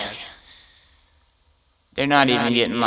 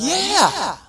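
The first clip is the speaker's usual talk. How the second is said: high, loud keen yeah; eager viewer yes; 3 dB louder than usual. Retold or imitated. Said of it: "People were like".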